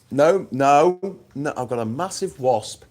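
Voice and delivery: ordinary talk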